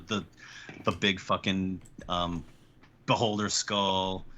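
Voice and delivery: normal talking voice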